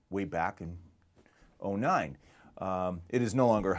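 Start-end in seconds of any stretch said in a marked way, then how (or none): none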